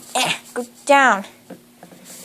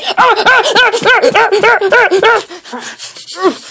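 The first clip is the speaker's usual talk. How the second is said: long gloating cackle; triumphant laugh; 5 semitones higher than usual. Acted. Kid gloating over the beaten thief, long triumphant laugh. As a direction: laugh like you've won.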